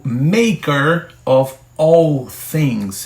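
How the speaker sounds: slow, careful "maker of all things" in English; English phrase. Shown as a demonstration. Teacher slowly models "maker of all things" for students. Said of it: "o escritor tá dizendo".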